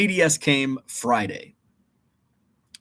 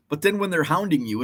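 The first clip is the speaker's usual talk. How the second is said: ordinary talk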